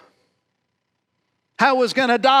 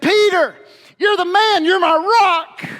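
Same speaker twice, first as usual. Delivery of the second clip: higher and louder, bursting with pride; joyful praise; 8 semitones higher, 5 dB louder than usual. Acted. Jesus praising Peter before the disciples, higher and louder with joy.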